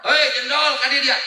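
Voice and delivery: put-on loud high call to a child. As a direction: call the kid over loudly. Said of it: "ngantorkeun anak".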